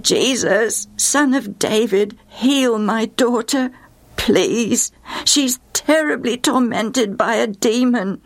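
high, drawn-out imploring call; excited calling out. A narrator's reading. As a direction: call out pleadingly without shouting. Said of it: "in her excitement, she began to call out".

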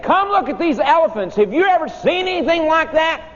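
put-on high, eager children's call to the teacher; excited kids calling out. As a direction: call him over like a thrilled schoolkid. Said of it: "and we said".

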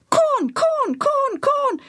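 very high parrot squawk. A narrator's reading. Parrot answering its owner's offer of corn, squawking. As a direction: echo the word in a squawky bird voice.

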